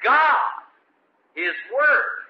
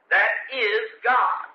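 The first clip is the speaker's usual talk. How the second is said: preaching shout; much slower than usual. Real, not acted. Preacher to congregation about God's word, slow booming shout. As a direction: proclaim it like a thunderclap. Preacher's climax after long pause.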